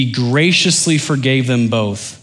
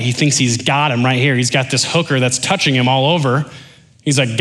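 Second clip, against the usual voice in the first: ordinary talk, nothing marked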